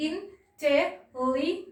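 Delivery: "intelli-" broken off after slow separate syllables; "intelli-" in detached beats, left unfinished. Shown as a demonstration. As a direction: split "intelli-" with long gaps. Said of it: "divide হচ্ছে".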